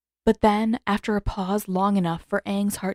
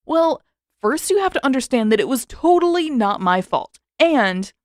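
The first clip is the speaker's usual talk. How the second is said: defensive; 6 semitones higher, 6 dB louder than usual. A narrator's reading. Sokka defending himself.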